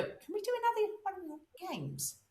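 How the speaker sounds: high, hammed-up eager voice; mock eager student. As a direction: imitate a keen participant. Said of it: "they would go".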